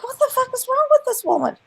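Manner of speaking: high, incredulous outburst; baffled and fed up, pitched high; retelling disbelief at the woman; exasperated disbelief. Retold or imitated. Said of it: "I'm like".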